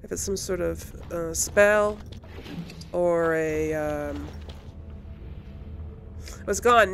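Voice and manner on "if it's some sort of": normal talking voice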